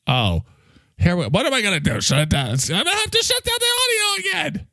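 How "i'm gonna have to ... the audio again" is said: very high, strained exasperated cry; exasperated outburst. Acted. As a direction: blurt it out in high-pitched frustration. Threatens in exasperation to cut audio.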